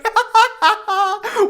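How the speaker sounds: squeaky excited laugh; can't hold back the laugh; gleeful laugh; high giggle. Real, not acted. Laughing at planned trade.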